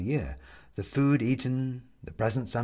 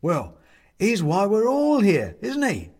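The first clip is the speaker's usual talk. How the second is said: high, louder gleeful burst; 10 semitones higher, 7 dB louder than usual. Acted. Bobs answering the young woman, gleeful and loud. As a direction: delight in the joke. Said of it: "says Bobs gleefully".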